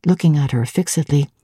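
plain reading voice

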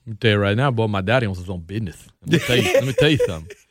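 quick, folksy mock Cajun talk; put-on old-timer Cajun accent. Shown as a demonstration. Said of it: "You can have a Cajun accent like".

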